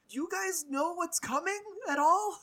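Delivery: high, loud mock alarm; retold incredulous warning. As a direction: pitch it way up, imitating disbelief.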